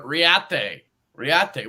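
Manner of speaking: Riate said as ree-ah-TAY; mocking "ree-ah-TAY" for Riate; French-sounding pronunciation. Shown as a demonstration. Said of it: "What are we, like, fucking French?".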